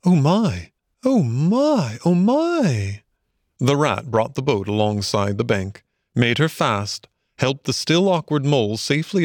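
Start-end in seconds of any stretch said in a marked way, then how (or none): 0.0-3.0 high, drawn-out swooping wonder; awed gasp. A narrator's reading. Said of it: "and gasp".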